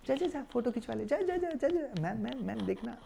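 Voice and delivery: hushed, high-pitched urgent urging; imitated eager fan. Acts fans urging family toward actress. (उतावले fan की दबी, ऊँची आवाज़ में नकल)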